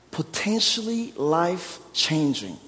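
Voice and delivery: usual speaking voice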